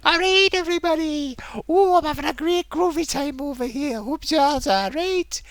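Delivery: high, groovy mock greeting; sitcom-cheery host. Imitating streamer like a Friends character, over-jolly. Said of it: "like he's on Friends or something".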